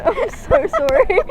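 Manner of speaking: high, slow, giggly apology; laughing apology. Real, not acted. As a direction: say sorry through a laugh. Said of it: "I'm a little bit insane".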